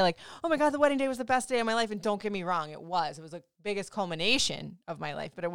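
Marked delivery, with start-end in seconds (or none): 0.4-1.9 high, fast, gushing mimicry; mock the starry-eyed newlyweds at full speed; gushing bride impression. Wife mocking brides who gush, high and fast. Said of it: "so many people say".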